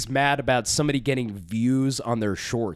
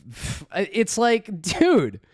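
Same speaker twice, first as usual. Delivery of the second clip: ordinary talk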